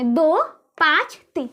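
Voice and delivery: slow and separate: दो, पाँच, तीन; digit by digit. Shown as a demonstration. Teacher demonstrating reading after the decimal, दो पाँच तीन one by one. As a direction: give दो, पाँच and तीन each its own beat. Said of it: "फिर एक एक कर देंगे".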